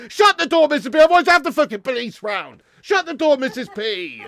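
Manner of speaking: high, loud urgent order, easing off; exasperated shouting. Real, not acted. Man tells Missus P to shut the door, exasperated shouting. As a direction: yell a warning across the house.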